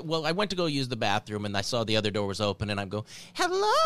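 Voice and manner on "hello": high, sweet and friendly hello. Retold or imitated. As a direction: greet a little kid gently from afar. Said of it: "I tried to use, like, a friendly voice".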